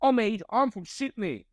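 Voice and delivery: high, mock Australian accent; Australian mate. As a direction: imitate a matey Australian from Sydney. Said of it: "asking people from Australia".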